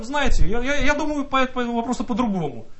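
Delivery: ordinary delivery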